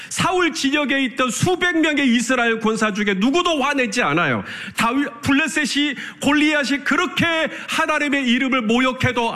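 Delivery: fervent preaching. Acted. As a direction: drive the point home line after line. Preacher hammers a repeated refrain. (힘주어 반복하는 설교조)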